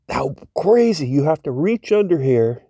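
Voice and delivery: usual speaking voice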